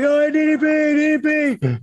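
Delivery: high, far-off fan chant; imitating calling fans. Fans across the parking lot calling to the wrestler, high mock chant. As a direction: call his name across the lot like a fan.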